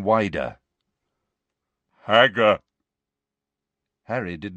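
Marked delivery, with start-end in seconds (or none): 2.1-2.6 loud, blunt giant's utterance. A narrator's reading. Giant peering down at the creatures, loud blunt call. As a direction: say it big, like a simple giant. Giant opens mouth, says word.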